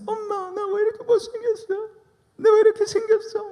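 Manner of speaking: very high falsetto sob-whine; mock crying sister. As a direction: ham up a weeping teenage girl. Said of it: "닭똥 같은 눈물을 툭툭 흘리면서".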